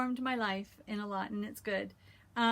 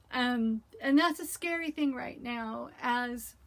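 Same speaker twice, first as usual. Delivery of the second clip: ordinary delivery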